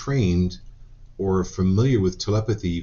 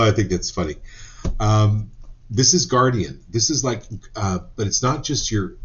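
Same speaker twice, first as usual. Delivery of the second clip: ordinary talk, nothing marked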